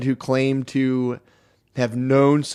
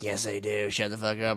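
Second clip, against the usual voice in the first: lower, softer, curt put-down; dismissive woman; 4 semitones lower, 11 dB quieter than usual. Retold or imitated. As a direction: brush them off low and quiet. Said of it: "the women with vaginas are like".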